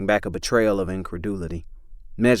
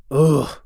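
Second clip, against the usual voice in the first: dutiful ugh; 7 semitones higher, 6 dB louder than usual. Acted. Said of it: "Becky dutifully interjected".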